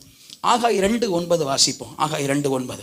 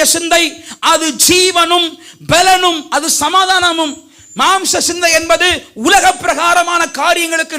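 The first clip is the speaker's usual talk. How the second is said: high-pitched, insistent pulpit rebuke; full-force preaching; 11 semitones higher, 8 dB louder than usual. Real, not acted. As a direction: drive each contrast home in a raised preacher's voice. Preaches at full force against phone habits. (உயர்ந்த குரலில் கண்டிக்கும் பிரசங்கம்)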